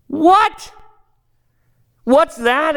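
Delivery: startled high exclamation; a sudden high cry of shock; exclaimed. Acted. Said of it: "exclaimed the ghost".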